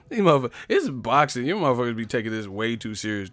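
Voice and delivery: normal talking voice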